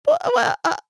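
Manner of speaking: high-pitched stammering protest; offended sputter. Acted. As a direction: sputter as if affronted. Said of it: "looks so of-, offended".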